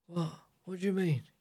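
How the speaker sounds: softly voiced old-man impersonation. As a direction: lightly mimic an old man waking up confused. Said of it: "Like an old man".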